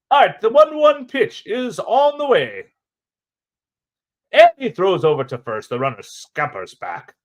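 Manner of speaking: 1940s announcer. Pretend. Streamer calling a pickoff throw in 1940s announcer voice. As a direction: savor each word like old radio. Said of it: "a forties announcer with some giggities".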